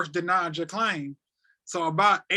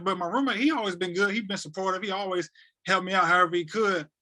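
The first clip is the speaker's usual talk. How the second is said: ordinary talk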